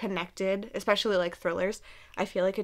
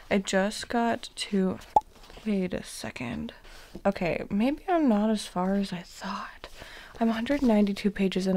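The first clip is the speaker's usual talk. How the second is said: hushed late-night whisper. Real, not acted. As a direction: whisper so nobody wakes. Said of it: "we have been whispering because Matt is asleep".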